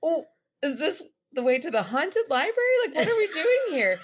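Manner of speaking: high, loud, laughing through words; laughing disbelief. Retold or imitated. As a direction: laugh while protesting, voice high. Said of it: "And I'm like".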